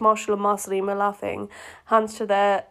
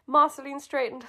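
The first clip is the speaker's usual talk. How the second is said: normal talking voice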